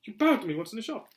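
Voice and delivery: put-on high, loud young voice; excited boy; eager boy. A narrator's reading. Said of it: "said Harry".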